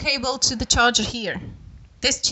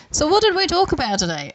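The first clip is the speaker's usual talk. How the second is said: high, quick Australian-sounding question; lilting mock question with an Australian accent; 7 semitones higher, faster than usual. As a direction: slip briefly into an Australian accent. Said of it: "say it in an Australian accent".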